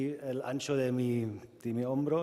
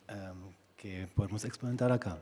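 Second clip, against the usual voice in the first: ordinary talk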